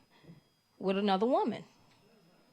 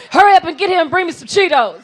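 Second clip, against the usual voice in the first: loud, high, laughing demand; loud laughing urging; 5 semitones higher, 16 dB louder than usual. Retold or imitated. Keisha urging friend on, loud and laughing. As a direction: yell it through laughs. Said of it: "That's Keisha".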